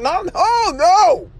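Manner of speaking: high, whiny protest of no, no, no; mock desperate refusal, high and pleading. Refusing wife, just woke up.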